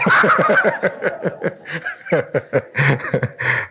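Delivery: real laughter running on for several seconds; long hearty laugh. Real, not acted. Preacher joking about which wife it was, laughing heartily. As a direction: break into a long, helpless chuckle.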